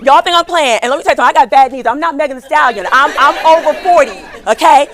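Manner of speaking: loud, high and fast laughing aside; excited. Real, not acted. Speaker joking to the audience, loud excited laughter. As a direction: shout it out to the crowd, laughing.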